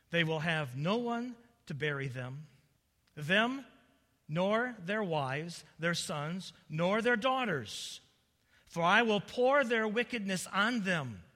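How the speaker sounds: loud, weighty scripture reading; declaimed scripture judgment. Acted. God pronounces judgment on deceived people, loudly declaimed. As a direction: pronounce the sentence phrase by phrase.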